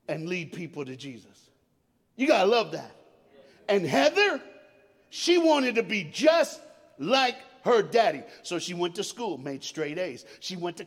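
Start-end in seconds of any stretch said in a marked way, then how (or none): 2.2-8.2 loud, rising preacher's cry; preaching at full force. Acted. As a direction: preach it hard to the congregation.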